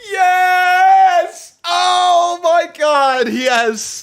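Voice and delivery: high, loud drawn-out celebration; ecstatic shout. Real, not acted. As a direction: shout it out in real delight, breaking into laughter. Thrilled the French voice works.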